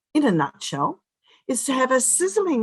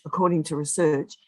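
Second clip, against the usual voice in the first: normal talking voice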